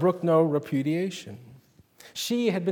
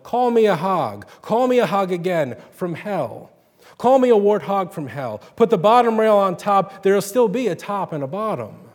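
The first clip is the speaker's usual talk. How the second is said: loud, higher defiant yell; 4 semitones higher, 6 dB louder than usual. A narrator's reading. Ruby yells across the pasture at God, loud. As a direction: dare heaven to answer. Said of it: "her voice rolled over the pasture".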